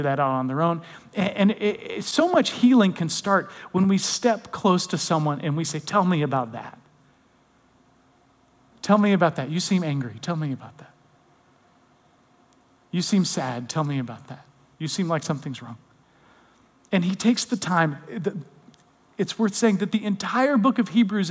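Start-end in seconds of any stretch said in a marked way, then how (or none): 5.8-15.8 soft, low, unhurried invitation to open up; gentle concern. Acted. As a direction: draw them out tenderly, leaving space. Models gently drawing out someone hurting.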